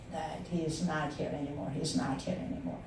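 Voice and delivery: subdued, sorrowful and hushed; quiet grief. Real, not acted. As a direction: let the loss sink the voice almost to nothing. Recalling a year of grieving.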